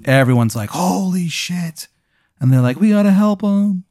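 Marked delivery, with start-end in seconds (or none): 0.7-1.9 slow, higher awed holy shit; stunned gasp. Acted. Crowd watching the player get smashed, stunned. 2.8-3.8 high, urgent alarm; alarmed crowd. Acted. Onlookers rushing to the fallen player, alarmed.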